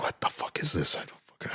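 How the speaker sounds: whispered disbelief, very soft; stunned whisper. Retold or imitated. Moviegoer reliving his confusion at the coin scene, stunned whisper.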